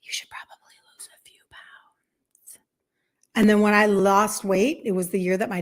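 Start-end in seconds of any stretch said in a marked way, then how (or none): 0.0-2.6 hushed, voiceless aside of a relative's remark; a sly, much softer whispered dig; mock whisper. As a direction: whisper it like an embarrassing secret. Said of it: "you were like".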